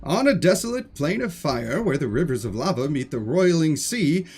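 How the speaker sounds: slow, theatrical storyteller narration; dramatic reading. Acted. Streamer indulging viewers in dramatic reading time, theatrical narration. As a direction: set an epic scene with sweeping, drawn-out phrases.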